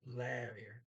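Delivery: "larier" opening on the vowel of "dad". Shown as a demonstration. Teacher showing the first syllable to learners, A as in dad. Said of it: "A as in dad".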